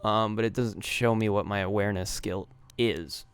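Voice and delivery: ordinary delivery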